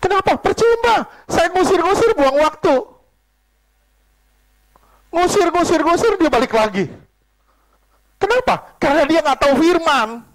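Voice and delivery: high-pitched, insistent pulpit outburst; emphatic preaching. Real, not acted. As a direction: fire questions and answers at the congregation. Preaches at full force. (khotbah menggebu-gebu dengan nada tinggi)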